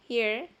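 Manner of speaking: "here" with the H like a Spanish J. Shown as a demonstration. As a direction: model "here" with a silent final E. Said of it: "H como J". ("here" con la H como J)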